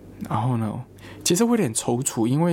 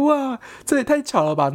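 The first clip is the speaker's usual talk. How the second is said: much higher, louder amazed exclaim; 12 semitones higher, 3 dB louder than usual. Retold or imitated. Telling friends about the coincidence, high amazed exclaim. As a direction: marvel at the coincidence out loud.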